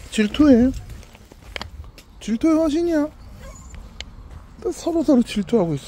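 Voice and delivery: high-pitched pet teasing. Real, not acted. Owner teasing two jealous dogs, high baby talk.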